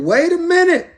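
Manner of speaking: high-pitched "wait a minute" from mama; mama calling a halt, pitched high; acted protest. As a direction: put your foot down, voice jumping up high. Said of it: "She said".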